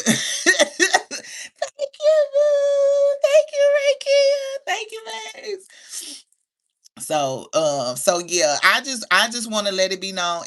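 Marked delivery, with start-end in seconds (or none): 0.1-5.7 very high, loud, stretched-out gushing; giddy falsetto shout-out; squealed thanks. Real, not acted. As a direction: squeal your thanks, then soften at the end.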